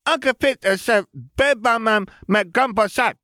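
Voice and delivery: put-on high, loud little boy; high urgent child; urgent toddler announcement. A narrator's reading. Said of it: "supremely urgent announcement".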